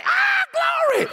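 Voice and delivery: very high, loud burst; sudden shout of glory. Retold or imitated. Churchgoer jumping up mid-sermon, shouting glory. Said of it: "jump up and say".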